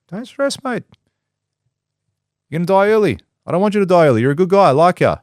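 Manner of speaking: calmed boss reassuring the worker, friendly. Retold or imitated. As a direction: pat him on the back with words. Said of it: "that person will be like".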